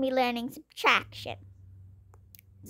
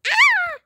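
sudden shrill yelp, much higher and louder; high scream; 8 dB louder than usual. Acted. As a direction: yelp as if shoved hard. Pushed in the crowd.

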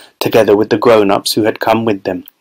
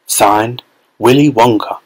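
usual speaking voice

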